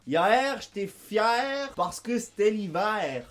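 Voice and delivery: hier, fier, hiver étirés en « a-ère »; diphtongue québécoise. Shown as a demonstration. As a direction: glisser de A vers È dans fier et hiver. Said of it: "Hier se dit ya R". (« fier », « hiver » à la québécoise)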